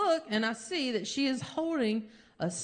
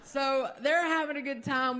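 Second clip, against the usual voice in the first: ordinary talk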